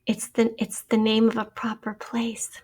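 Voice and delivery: breathy whisper, almost no voice; a conspiratorial whispered tip to students; whispered hint. Real, not acted. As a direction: lean in and whisper the clue. Teacher whispers a spelling hint.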